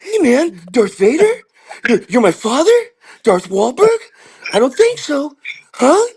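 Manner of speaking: high, puzzled movie-star impression; mock-baffled caricature, high and halting; Mark Wahlberg. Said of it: "Mark Wahlberg's in every single movie".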